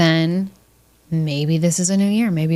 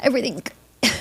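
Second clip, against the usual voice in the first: high, strained squeeze; tight, put-on fake cheer; constipated, gritted teeth; 5 semitones higher, 3 dB quieter than usual. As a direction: push it out through a clenched jaw. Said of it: "I sound constipated when I do that".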